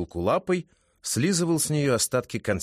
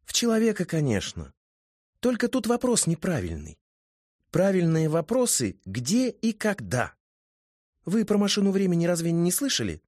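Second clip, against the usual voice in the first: put-on high, chatty girl voice; a lively young woman; high female voice; 7 semitones higher than usual. A narrator's reading.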